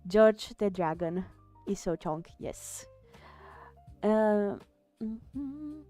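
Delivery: ordinary talk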